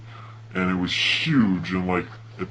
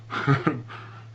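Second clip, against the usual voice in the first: breathy laugh; 7 semitones higher than usual. Real, not acted. Laughing at friend sprinting.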